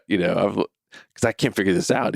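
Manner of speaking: ordinary delivery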